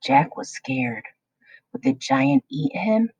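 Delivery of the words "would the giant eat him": plain reading voice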